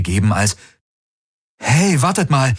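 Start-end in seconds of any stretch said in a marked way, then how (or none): 1.6-2.5 much higher, sudden call; excited exclamation. A narrator's reading. Garland spots something in the trees, excited call. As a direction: call out for the others to wait. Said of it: "rief er aus".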